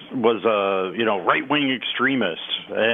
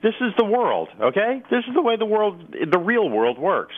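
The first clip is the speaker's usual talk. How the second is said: higher, firm matter-of-fact quote; quoting Kavanaugh; 7 semitones higher than usual. Retold or imitated. Quoting Kavanaugh's opinion, firm and higher. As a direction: lay down the law, lightly voiced. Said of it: "Brett Kavanaugh says".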